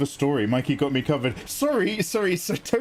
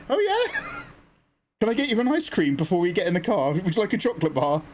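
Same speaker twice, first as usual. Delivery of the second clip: cheery friend; 6 semitones higher than usual. Retold or imitated.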